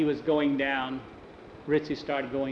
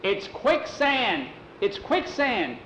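higher, slow alarmed cry; 7 semitones higher, much slower than usual. Acted. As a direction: call out the danger. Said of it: "Tom yelled".

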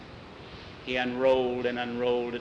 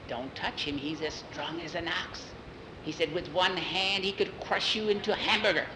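hushed warning; 4 semitones higher, 5 dB quieter than usual. Acted. Witch warns her angry sister.

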